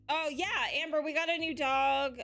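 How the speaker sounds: normal talking voice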